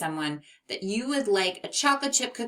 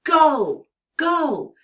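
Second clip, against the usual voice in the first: strong go; 9 semitones higher, 9 dB louder than usual. Shown as a demonstration. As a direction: go much higher and louder. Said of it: "So try saying go".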